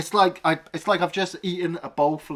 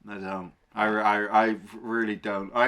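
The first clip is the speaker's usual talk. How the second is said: usual speaking voice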